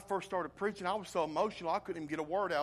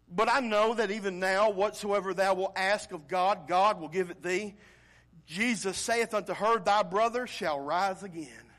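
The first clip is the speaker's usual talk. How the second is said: fervent scripture reading; 4 semitones higher, 8 dB louder than usual. Acted.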